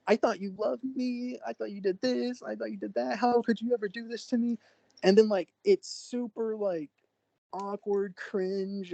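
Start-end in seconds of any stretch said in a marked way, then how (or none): 0.1-4.6 retold yelling. Girlfriend accuses him of betrayal, mock yelling. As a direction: imitate an upset accuser in a raised pitch. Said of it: "yelling at me".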